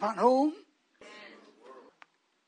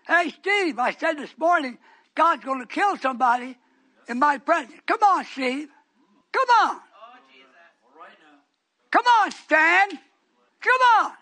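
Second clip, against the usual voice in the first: loud, high rebuke from the pulpit; full-force preaching; 5 semitones higher, 13 dB louder than usual. Real, not acted. Preacher confronts Steve and Stan, full-force rebuke. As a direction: call him out at full voice, pitched up.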